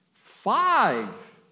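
Five shouted high and loud; Five called out loud and high; stressed. Shown as a demonstration. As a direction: punch Five hard. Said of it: "makes it emphatic".

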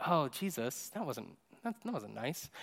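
hushed, hesitant reproach; very soft, halting awkwardness; uncomfortable. Retold or imitated. Uncomfortable listeners to Jesus after his outburst, hushed and awkward.

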